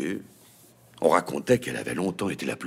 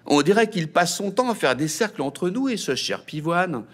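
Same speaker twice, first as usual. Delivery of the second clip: sly tease; 10 semitones higher, 3 dB louder than usual. A narrator's reading. Brother teasing sister.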